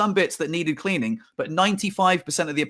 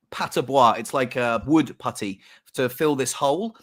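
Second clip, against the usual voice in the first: ordinary talk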